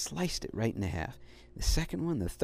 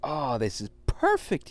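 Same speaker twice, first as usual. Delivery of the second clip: delighted little dog; 4 dB louder than usual. Acted. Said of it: "he thought".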